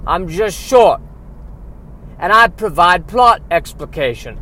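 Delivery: high, loud elf voice wobbling between bad Cockney and Southern accents; a house elf sliding from a hammed-up British accent into a Southern one; bad mixed accents. House elf explaining his family to the audience, in hammed-up mixed British and Southern accents. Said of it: "my long parade of bad accents".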